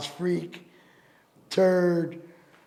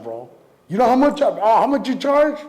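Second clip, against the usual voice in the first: ordinary talk, nothing marked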